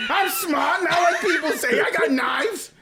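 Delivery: high, hammed-up movie crazy; mock indignant movie outburst; Dog Day Afternoon. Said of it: "He's doing, like, Dog Day Afternoon".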